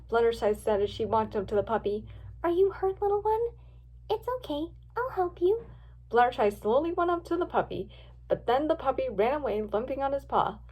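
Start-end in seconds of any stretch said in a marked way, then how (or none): 2.4-5.7 put-on high-pitched, tender girl voice; small, high and reassuring; gentle girl. A narrator's reading. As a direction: soothe an injured puppy in a high voice. Girl soothing a hurt puppy.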